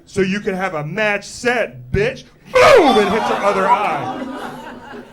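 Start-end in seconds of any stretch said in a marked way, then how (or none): 0.1-2.2 loud, punched-out comeback; mock tough retort. Acting sister's retort before second punch. 2.5-3.0 explosive high shout imitating a blow; sudden, very loud mock impact; booming punch noise. Acting out the second punch.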